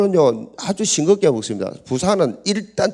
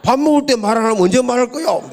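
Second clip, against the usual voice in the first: higher, annoyed comeback; irritated retort; 8 semitones higher than usual. Retold or imitated. Son-in-law snapping at his father-in-law, higher and irritated. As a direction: snap back at the in-law. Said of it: "신경질이 확 나는 거요".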